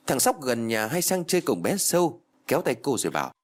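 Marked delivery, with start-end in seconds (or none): none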